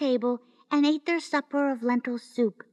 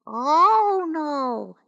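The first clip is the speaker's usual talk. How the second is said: loud refusal; 2 semitones higher, 6 dB louder than usual. A narrator's reading. Husband refuses.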